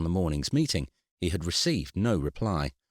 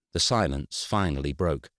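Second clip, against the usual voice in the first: plain reading voice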